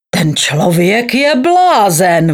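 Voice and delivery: slow, drawn-out exclamation; calling out. A narrator's reading. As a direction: call out the accusation, stretching it. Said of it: "volal doktor Link". (protáhlé rozhořčené zvolání)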